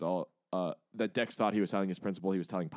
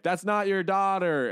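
retorting Tony; 9 semitones higher, 4 dB louder, slower than usual. Retold or imitated. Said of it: "Tony was like".

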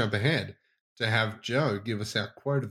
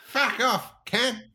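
higher mock Australian "fuck off"; 12 semitones higher, much slower than usual. Co-host answering teasing with a mock Australian jab. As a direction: throw out a cheeky Australian insult, pitched up.

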